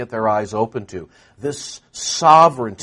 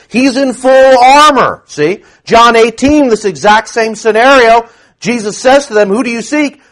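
high, loud, ringing sermon voice; preacher in full cry; full-force preaching; 9 semitones higher, 8 dB louder than usual. Real, not acted. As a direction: preach it at the top of your voice.

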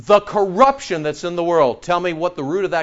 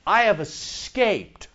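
deliberate emphasis; 2 semitones higher, 4 dB quieter, slower than usual. Real, not acted. Savouring the verse's key word.

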